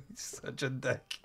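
ordinary talk

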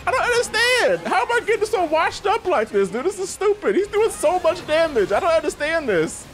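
high-pitched incredulous grumbling; frustrated whine. Real, not acted. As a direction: whine about being crushed. Being beaten, frustrated whining.

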